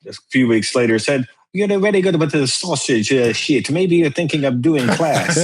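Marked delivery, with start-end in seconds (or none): 1.5-5.4 pitched-up Italian accent impression. Italian friend nudging Bill toward teaching classes, Italian accent. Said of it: "with a very heavy Italian accent".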